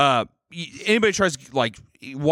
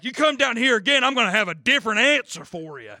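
much higher, pressing threat, hammed-up; mock threat; 10 semitones higher than usual. Neighbor threatens intruder.

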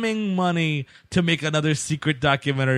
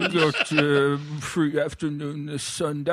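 slow, soft dragon actor voice; laughing through a mock dragon drawl; 6 dB quieter than usual. Continuing the dragon actor bit.

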